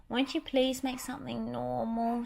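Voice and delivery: begging Minnie. Acted. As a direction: plead with him gently. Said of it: "she begged".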